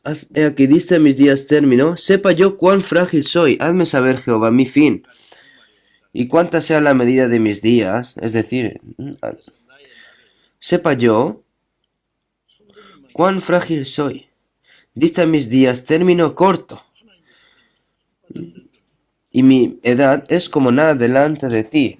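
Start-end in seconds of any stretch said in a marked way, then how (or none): 0.0-8.2 raised, lifted psalm reading; scripture reading. Acted. Preacher reading a psalm to the congregation, lifted reading. 10.6-22.0 scripture reading. Acted. Preacher rereads the verse slowly.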